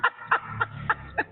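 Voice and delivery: a high, airless snicker of scorn; breathy mocking laugh. Real, not acted. Debater snickers at his opponent's answer, breathy mocking laugh. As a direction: laugh under your breath at him. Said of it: "آپ ہنس کیوں رہے ہیں اتنا؟".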